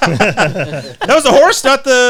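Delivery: high, laughing squeal; laughing disbelief. Real, not acted. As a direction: laugh through the words.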